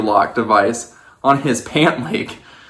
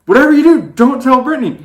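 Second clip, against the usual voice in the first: urgent plea; 13 semitones higher, 5 dB louder, faster than usual. Retold or imitated. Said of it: "we're like".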